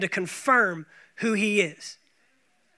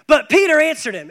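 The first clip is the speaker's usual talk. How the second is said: louder, higher punch on Peter; dramatic emphasis; 5 semitones higher, 7 dB louder than usual. Acted. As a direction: hit Peter like a twist. Preacher punches the turn.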